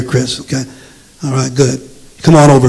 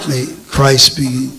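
prayer; 4 semitones lower, 5 dB quieter than usual. Acted. Leads the congregation in prayer.

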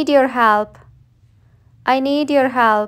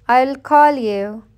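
ordinary talk, nothing marked

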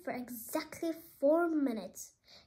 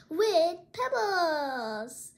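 very slow, stretched-out words; drawn out; 2 semitones higher, 6 dB louder, much slower than usual. Real, not acted. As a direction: stretch it out to tease tomorrow. Announcing tomorrow's craft.

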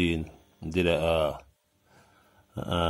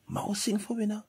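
put-on child's request. Kids from school asking.

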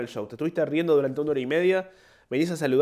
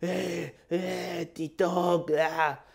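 high, soft mock squeals of kids; hyped-up teens; 3 semitones higher, 4 dB quieter, much slower than usual. Queue of hormonal kids after the show, hyped-up teen imitation. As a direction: imitate overexcited youngsters, pitched up and held back. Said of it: "pibitos con todas las hormonas exaltadas".

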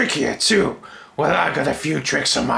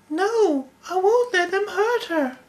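put-on high young voice. High young character protests.

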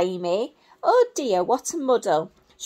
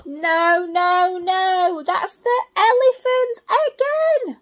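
high, louder fed-up little monkey; exasperated monkey; 8 semitones higher, 5 dB louder than usual. Acted. Little monkey to butterfly, high exasperated squeak. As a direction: squeak out the frustration, much higher.

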